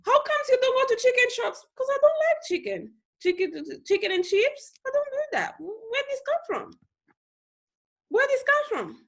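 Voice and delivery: very high-pitched, mock-incredulous voice; imitated puzzled critics. Acting out people questioning how she speaks, mock-incredulous. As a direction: squeak it out as a baffled exchange. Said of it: "When people see me, they".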